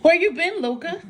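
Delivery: sudden loud high call; startling boo. Acted. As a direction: jump out and startle her. I'm gonna try and scare Luna.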